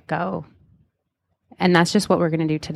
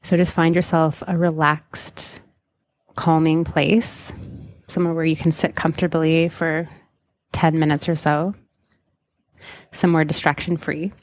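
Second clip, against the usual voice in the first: guided meditation. Acted. Leads a hypnotic guided meditation.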